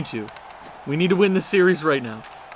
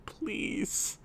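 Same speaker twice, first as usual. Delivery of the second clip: desperate whisper; 5 semitones higher, 12 dB quieter than usual. Real, not acted. Desperate fan begging.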